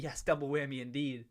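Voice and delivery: ordinary talk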